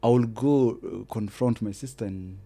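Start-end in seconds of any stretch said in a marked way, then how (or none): none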